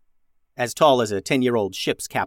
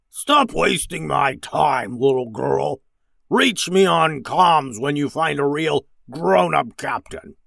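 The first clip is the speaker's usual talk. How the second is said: slow, scornful alien villain; drawn-out, sneering creature captain; slimy, grumbly creature; 3 semitones higher, slower than usual. A narrator's reading. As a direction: dismiss her in a grumbly frog voice. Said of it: "in a slimy, grumbly voice".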